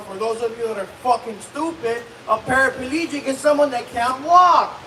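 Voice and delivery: ordinary delivery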